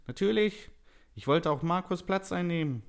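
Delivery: high, loud elf villain; scheming elf. A narrator's reading. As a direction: admit it brazenly in a high elf voice. Villain elf admits his scheme.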